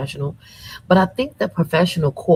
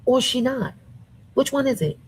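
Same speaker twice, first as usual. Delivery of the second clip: high and pointed; incredulous challenge; 6 semitones higher than usual. Real, not acted. Challenging her critics, high and disbelieving. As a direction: press them for an answer.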